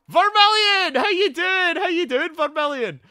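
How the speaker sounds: high, loud whoop of welcome; real excited yell, very high; excited shouted greeting. Real, not acted. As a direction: holler hello to an arriving friend. Shouted greeting to an arriving viewer.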